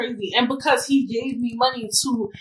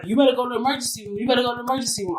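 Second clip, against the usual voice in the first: boss urging; 2 semitones higher, much faster than usual. Retold or imitated. Boss urging her to leave.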